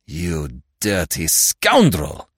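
slow, low seething mutter; muttered insult. Acted. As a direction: spit it under the breath. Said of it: "he muttered".

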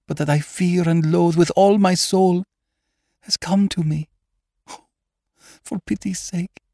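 high, desperate plea, rising to a cry; pleading frightened lady. Acted. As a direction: beg him for hope, terror mounting. Frightened woman pleads for hope.

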